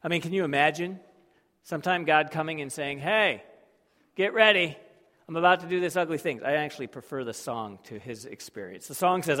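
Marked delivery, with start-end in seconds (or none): 3.0-6.4 urgent warning. Retold or imitated. God voiced warning.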